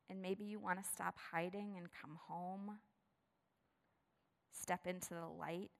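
soft, hushed pastoral voice; quiet closing prayer invitation; prayer lead-in. Real, not acted. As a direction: invite them gently toward prayer. Leads into prayer.

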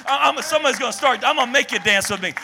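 fired-up preaching. Real, not acted. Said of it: "I'm preaching, I'm feeling God".

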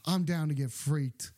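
deep voice. Acted. Drops into deep voice.

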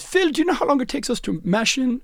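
lightly voiced brewmaster putting a question; German brewmaster. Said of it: "the brewmaster looked at him and said".

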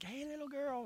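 put-on soft, high-pitched call to a small child; tender coaxing. Preacher voicing Jesus waking the girl, tender coaxing. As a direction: gently rouse a little girl. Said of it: "It's like".

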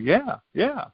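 high, quick flustered agreement; flustered yes. Retold or imitated. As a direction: jump up in pitch, unsure. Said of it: "I'm like".

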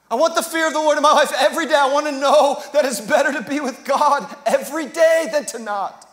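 loud, driving preacher cry; full-force preaching. Acted. Pastor pleading with the church, loud and driving. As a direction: lift the room with it.

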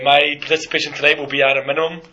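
ordinary talk, nothing marked

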